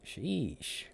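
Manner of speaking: normal talking voice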